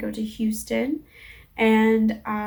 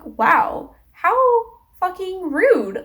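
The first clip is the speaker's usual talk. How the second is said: higher, drawn-out retold indignation; indignant disbelief; 8 semitones higher, 4 dB louder, much slower than usual. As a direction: stretch it out, appalled at the rudeness. Retold indignant reaction to the clerk.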